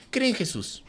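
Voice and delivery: mocking young Christians holding up signs; mock street preacher. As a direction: say "Cree en Jesús" like a naive kid with a sign. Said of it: "evangelizar así con tu cartulina".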